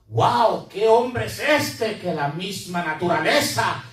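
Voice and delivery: awed exclamation. Acted. Awed reaction to calmed storm.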